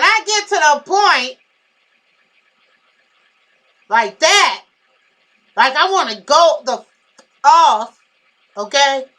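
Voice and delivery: loud, heated yell, showing how it sounds when she's really mad. Shown as a demonstration. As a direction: let it blow up into a real shout. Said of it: "Yeah, I'm yelling at that point".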